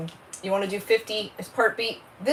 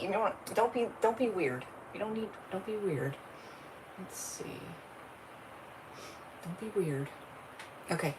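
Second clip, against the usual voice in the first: hushed aside; 13 dB quieter than usual. Real, not acted. Hushed aside to someone off camera.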